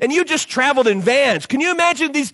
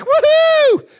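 high loud woohoo; cheering whoop; 5 dB louder than usual. Pretend. Disciple hearing about rest, loud high cheer. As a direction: whoop with joy.

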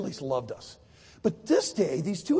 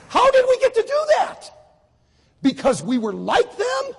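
high, loud preacher's questions; rhetorical challenge; 7 dB louder than usual. Real, not acted. Preacher challenging young listeners on his story, loud and incredulous. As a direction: throw the question hard at the crowd.

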